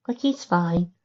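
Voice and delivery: high, small filly voice; little filly murmur. A narrator's reading. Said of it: "Lucy murmured".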